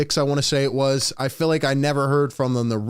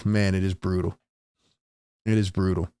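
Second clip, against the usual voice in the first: ordinary talk, nothing marked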